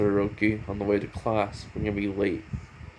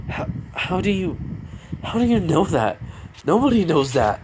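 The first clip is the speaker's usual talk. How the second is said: shocked; 8 semitones higher than usual. Retold or imitated. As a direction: blurt it out in stunned disbelief. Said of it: "in shock of what he said".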